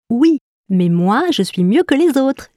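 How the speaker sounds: high little note, boastful; high-voiced note. A narrator's reading. Note insists to the others, high and boastful. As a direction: swoop upward as you claim to be best. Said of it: "dit Sol".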